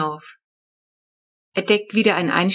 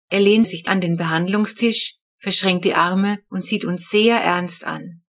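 ordinary delivery, nothing marked

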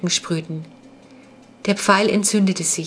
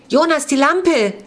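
high, loud panicked shout; yelling; 8 semitones higher, 4 dB louder than usual. A narrator's reading. Clara shouting at Jonas to shine the lamp. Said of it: "brüllte sie".

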